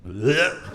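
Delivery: short mock gag; retching noise. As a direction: imitate a queasy wife throwing up. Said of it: "And she went".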